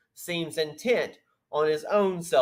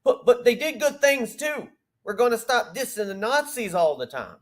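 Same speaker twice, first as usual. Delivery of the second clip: mocking Yi; 8 semitones higher than usual. Host mimicking Yi interrupting, in a higher mock voice. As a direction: parody him eagerly cutting in. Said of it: "Yi interrupts, goes".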